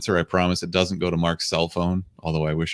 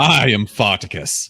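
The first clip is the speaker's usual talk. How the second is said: mock heroic claim. Parody of I'm Spartacus.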